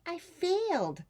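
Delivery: high, put-on little-girl voice; Rosie's small voice. Rosie telling her aunt she failed, high child voice. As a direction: sound like a crestfallen child. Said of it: "said dear Rosie".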